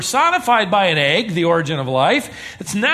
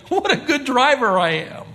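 smug self-praise; 7 semitones higher than usual. Retold or imitated. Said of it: "and you think".

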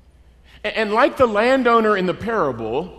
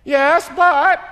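loud, high-pitched protesting concession; reluctant admission; 11 semitones higher, 7 dB louder than usual. Acted. Cornered worker answering landowner, protesting. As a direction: concede it, then protest loudly. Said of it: "the toughest question to answer".

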